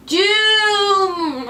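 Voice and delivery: long, high wail on DOOM; wailing prophecy. Acted. Cassandra warning her city, long high wail. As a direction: draw DOOM out like a siren. Prophecy cried out, drawn-out repeat.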